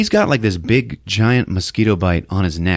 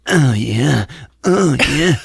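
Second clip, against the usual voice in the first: mock moaning; 6 semitones higher, slower than usual. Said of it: "going like".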